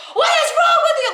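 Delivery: very high-pitched, outraged screech; screaming. Retold or imitated. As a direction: re-enact screaming at the top of your voice. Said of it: "I was screaming so loud".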